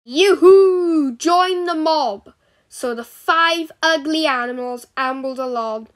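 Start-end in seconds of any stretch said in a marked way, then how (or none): 0.0-2.3 high, loud calling out, slow invite; loud yoo-hoo. A narrator's reading. Four animals calling to the stork: loud slow yoo-hoo. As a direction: hail them from afar, then welcome. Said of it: "Then the other four shouted".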